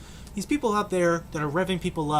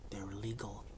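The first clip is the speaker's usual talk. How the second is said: whisper; 5 semitones lower, 12 dB quieter than usual. Retold or imitated. Voicing the hunters' mindset.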